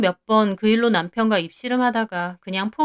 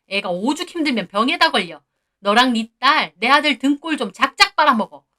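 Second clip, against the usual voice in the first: mother-in-law's rant; 2 semitones higher, 3 dB louder than usual. Acted. Mother-in-law ranting at the wife about her son, loud and furious. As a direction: pound every accusation home.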